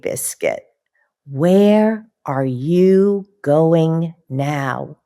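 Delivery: lively, slow picture-book reading with puppy noises; reading to children. Acted. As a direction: read it like bedtime story time for little kids.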